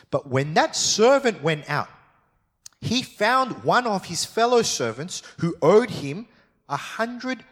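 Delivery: preacher reading scripture aloud. Acted. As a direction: read the Bible passage out to the congregation. Reads part two aloud.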